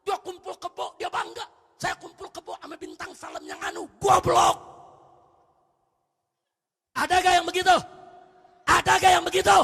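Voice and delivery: loud, high sermon shout; full-force preacher in outrage; fiery preaching. Real, not acted. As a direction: thunder indignant questions at the congregation.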